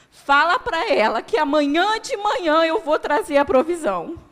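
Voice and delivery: booming, loud, high proclamation; voice of God. Retold or imitated. The Lord promising his provision to the sick woman, booming. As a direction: announce it like a divine promise. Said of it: "O Senhor falou comigo assim".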